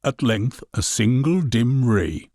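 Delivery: plain reading voice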